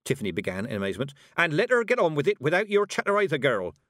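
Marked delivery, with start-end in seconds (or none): 1.4-3.8 put-on loud, higher sharp rebuke from the old witch; snapping. A narrator's reading. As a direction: cut the girl off sharply. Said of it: "the witch snapped".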